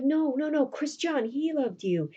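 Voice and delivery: high, hurried-sounding protest; acted denial. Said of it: "he tries to deny it".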